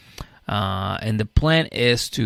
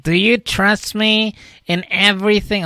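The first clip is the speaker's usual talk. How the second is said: much higher, louder questioning voice; high woman's voice; 10 semitones higher, 5 dB louder than usual. Retold or imitated. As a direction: ask it in a high fussy tone. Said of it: "she's like".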